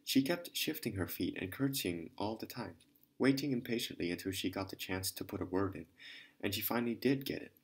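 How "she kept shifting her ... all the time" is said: usual speaking voice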